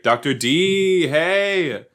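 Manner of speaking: high, drawn-out gushing greeting; singsong student squeal; excited students. Acted. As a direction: hail a favorite professor, stretched and high. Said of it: "her students would be like".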